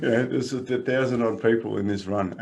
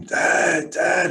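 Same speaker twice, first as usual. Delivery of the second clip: higher-pitched imitation of a child screaming for dad; son's mock scream; 9 semitones higher, 4 dB louder than usual. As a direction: mimic a kid calling out in alarm. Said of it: "I hear him scream".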